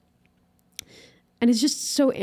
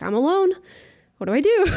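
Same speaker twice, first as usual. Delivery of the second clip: mock panic; 9 semitones higher, 5 dB louder, faster than usual. Panicked at closet, recalling.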